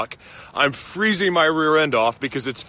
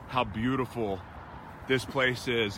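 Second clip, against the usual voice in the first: normal talking voice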